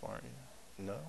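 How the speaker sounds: ordinary talk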